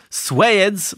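Swedes as "swayds" in a Dublin accent; high, loud Dublin take on Swedes. Shown as a demonstration. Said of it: "sounds to me like a Dublin version".

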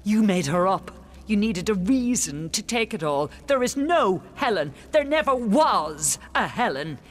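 loud, rising denial; defiant retort. Acted. Queen denying Helen to the king, defiant and loud.

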